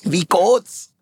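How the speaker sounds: high, mock Swiss-German lilt; Schweizer Hochdeutsch. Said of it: "so sein Schweizer Hochdeutsch". (übertriebener Schweizer Akzent, hoch)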